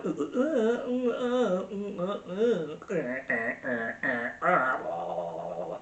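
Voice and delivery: exaggerated choking vocal-fry noises; mock Valley croak. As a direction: imitate an awful voice as wordless croaks. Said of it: "that sort of weird valley".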